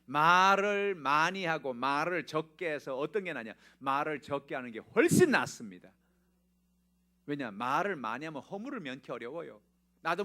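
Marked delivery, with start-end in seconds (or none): 0.1-5.9 loud, slow pulpit point; emphatic preaching. Acted. Pastor presses the congregation to speak less, loud and slow. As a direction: lean hard on the lesson.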